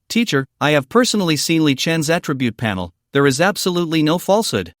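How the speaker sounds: usual speaking voice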